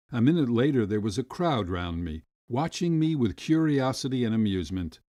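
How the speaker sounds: ordinary delivery, nothing marked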